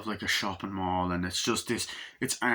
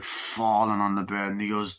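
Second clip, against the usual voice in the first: ordinary talk, nothing marked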